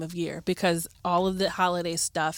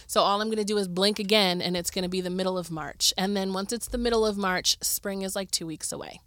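usual speaking voice

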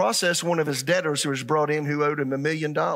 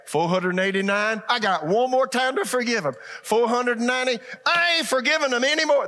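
higher, building to a loud mock outburst; mock indignation; 8 semitones higher, 3 dB louder than usual. Preacher mimicking someone who has run out of forgiveness, mock indignant build. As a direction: play someone counting forgiveness, rising to an indignant refusal. Acts out counting down to refusing forgiveness.

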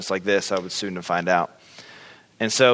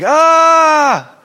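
a sudden high piercing mock scream; loud high imitated yell; 11 dB louder than usual. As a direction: shriek out loud like a stranger in the crowd. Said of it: "just screaming".

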